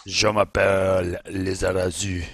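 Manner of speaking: slow, hammed-up French accent; a playful French intro, in mock French. Mates joking on a podcast, mock French accent. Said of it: "What a French name".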